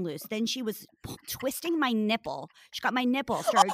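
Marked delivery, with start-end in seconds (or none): 3.4-3.7 sudden high outburst. Real, not acted. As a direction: voice jumps high and loud. Violent struggle told, voice jumps.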